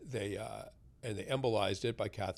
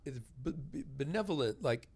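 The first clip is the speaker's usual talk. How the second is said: normal talking voice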